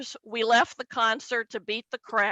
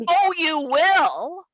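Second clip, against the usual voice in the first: slow, higher mock coy; sly dad; 5 semitones higher, 4 dB louder, slower than usual. Dad teasing daughter about his birthday, slow and sly. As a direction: drag it out knowingly.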